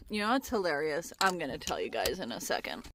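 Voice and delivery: ordinary delivery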